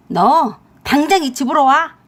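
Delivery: loud, furious summons; angry command. A narrator's reading. Mother-in-law summoning her son home, angrily shouting. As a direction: order your son home this instant.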